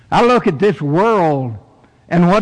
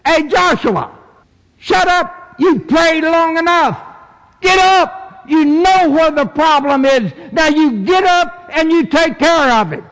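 high, commanding preacher's God; stern voice of God; 13 semitones higher, 3 dB louder than usual. Acted. God coming up behind praying Joshua, stern command. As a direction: order him up, pitched high and forceful. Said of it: "I heard the stern voice".

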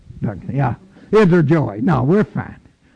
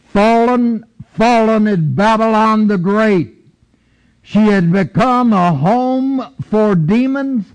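a mighty angelic shout; booming heavenly proclamation; 4 semitones higher than usual. Acted. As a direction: proclaim it high and strong like an angel. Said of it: "he says in this mighty voice".

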